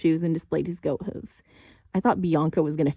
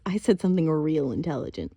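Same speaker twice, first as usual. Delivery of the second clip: ordinary delivery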